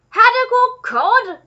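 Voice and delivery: spitting out the words; high, slow, loud disbelief; disgusted shout. A narrator's reading. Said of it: "shouted the Duke, spitting out the words".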